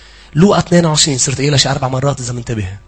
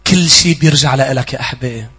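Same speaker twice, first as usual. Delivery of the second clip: usual speaking voice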